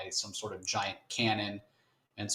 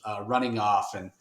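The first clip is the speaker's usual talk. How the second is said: normal talking voice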